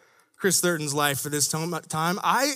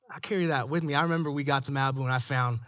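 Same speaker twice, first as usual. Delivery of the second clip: normal talking voice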